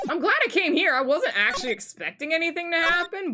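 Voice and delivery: high, gushing voice; elated collector. Acted. Collector tells investigator she's glad she came, elated and high. Happy collector after trade.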